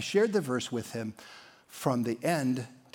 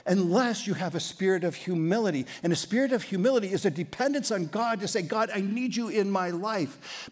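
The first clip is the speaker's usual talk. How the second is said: impassioned preaching; 6 semitones higher than usual. Acted. Preaching peak.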